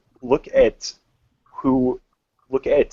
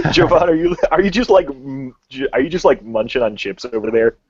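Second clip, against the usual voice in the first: laughing through the question; giggling, voice pushed up high; 7 semitones higher, 9 dB louder than usual. Real, not acted. Laughing while teasing a crunching friend.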